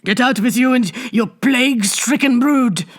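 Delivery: hoarse, high shout. A narrator's reading. Bibot drives the plague hag out, hoarse shout. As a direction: drive her off. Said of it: "shouted Bibot hoarsely".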